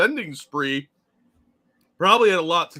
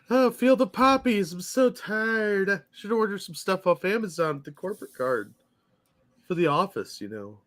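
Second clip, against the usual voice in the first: drowsy drugged voice; 6 semitones higher than usual. Acted. Mock dazed poppy-field office worker.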